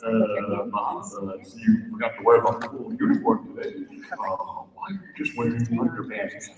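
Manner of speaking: low, soft grumbling boss, quick; a deep-voiced boss scolding; gruff boss voice. Boss questions Bob's missing uniform, low grumble. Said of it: "His boss said".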